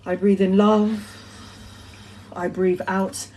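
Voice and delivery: soft mindful breathing cue; guided breathing. Shown as a demonstration. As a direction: breathe the words out calmly, like leading a meditation. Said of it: "through mindful meditation".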